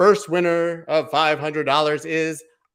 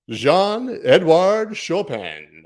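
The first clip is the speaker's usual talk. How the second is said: slow, drawn-out player intro; announcer voice; 5 semitones higher, 6 dB louder, much slower than usual. Pretend. Streamer calling out eighth winner, arena announcer voice. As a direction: roll the name out for the crowd. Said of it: "I have a pretty good announcer voice".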